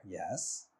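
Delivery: ordinary delivery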